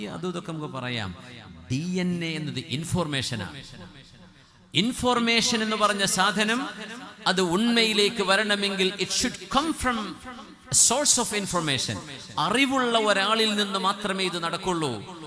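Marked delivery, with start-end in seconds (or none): 4.7-15.2 high, loud, slow impassioned sermon; preaching at full force. Acted. Preacher to his congregation on DNA, fiery sermon voice. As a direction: drive the point home to the crowd.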